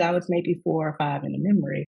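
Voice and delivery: normal talking voice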